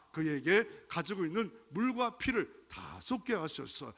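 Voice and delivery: ordinary talk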